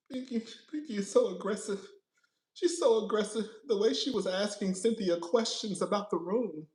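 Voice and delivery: softly mocking fan gripe; mock complaint. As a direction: mumble it like a petty complainer.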